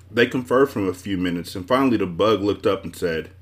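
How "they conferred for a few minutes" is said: plain reading voice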